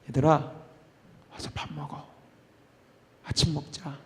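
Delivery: 낮고 숨소리 섞인 조용한 부름; 귓가에 속삭이듯 불러 주기; 속삭이듯 다정한. Acted. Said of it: "이 예수님의 한마디". (속삭이는 다정한 목소리)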